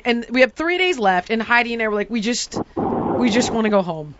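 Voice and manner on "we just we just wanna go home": low, weary mumble; defeated plea. Retold or imitated. Traveller after pouring rain wanting home, defeated. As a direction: say it worn down, all fight gone.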